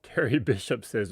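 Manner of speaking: ordinary talk, nothing marked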